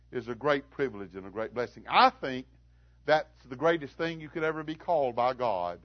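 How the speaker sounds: normal talking voice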